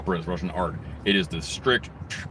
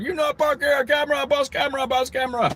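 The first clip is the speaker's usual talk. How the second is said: high mocking broken English, much higher; hammed-up imitation of the lot owner; mock foreign boss. Mocking the lot owner who caught him parking, high broken English.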